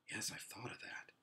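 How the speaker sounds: hushed whisper. A narrator's reading. Boy confiding to Polly in a whisper. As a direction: breathe it secretly.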